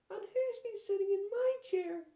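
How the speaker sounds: very high, loud little bear wail; wailing Baby Bear. A narrator's reading. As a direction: wail it like a distraught cub. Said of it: "wailed Baby Bear".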